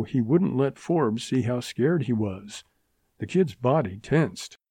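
ordinary delivery, nothing marked

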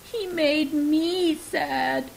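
slow, high tearful wail; a little girl crying out that he hurt her. A narrator's reading. Upset sister crying to her sibling about Santa. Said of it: "she cried".

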